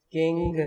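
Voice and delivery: کنگ ending on a velar ng; king demo. Shown as a demonstration. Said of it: "تو یہ والی sound ہے". (کنگ کو پیچھے سے این جی آواز کے ساتھ بولیں)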